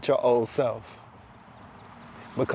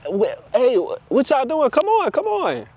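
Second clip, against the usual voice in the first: imitated urgent yell, pitched way up; mock yelling; 12 semitones higher than usual. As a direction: holler after stragglers at half volume. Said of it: "Or you yelling like".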